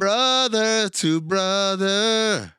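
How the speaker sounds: dramatic drag. Pretend. Mock dramatic line reading.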